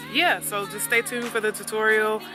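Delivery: ordinary talk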